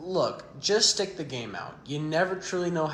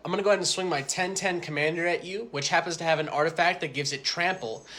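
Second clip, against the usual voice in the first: normal talking voice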